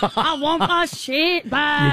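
bad Scottish accent; a mock Scottish farmer wailing for his sheep. Co-host playing a farmer slips into Scottish. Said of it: "You're going Scottish".

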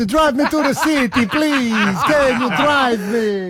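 high, slow put-on begging voice; mock pleading. Host teasingly begging his co-host for a lift, high mock whine. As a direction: beg for a lift in a silly high voice.

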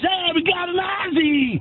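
excited New York vendor. Retold or imitated. Hot dog seller spots an Australian customer, mock-excited. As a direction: hail the customer like a street vendor. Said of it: "And he goes".

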